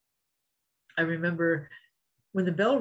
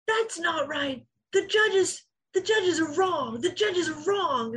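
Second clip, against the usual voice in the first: mocking the sore loser; 10 semitones higher, slower than usual. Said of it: "so spiteful and so mean".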